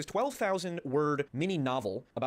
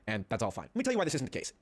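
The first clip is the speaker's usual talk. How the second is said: usual speaking voice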